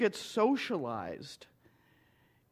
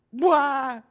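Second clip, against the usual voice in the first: tearful cry; 5 semitones higher, 8 dB louder than usual. Retold or imitated. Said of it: "Tears running down my face".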